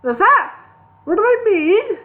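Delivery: high, loud, slowed wonder; marvelling. A narrator's reading. Gandalf marvelling at the Elvish letters, loud and high. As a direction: gasp in delight at the letters. Deaf wizard mishears.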